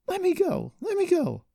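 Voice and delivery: very high, loud cries; struggling shout. A narrator's reading. Daughter pinned by her friend, frantic struggling shouts. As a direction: shout to be released.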